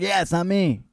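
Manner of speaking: high, loud put-on voice; hammed-up high-pitched agreement; eager denial. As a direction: pipe up to agree emphatically. [name] eagerly agrees it isn't him.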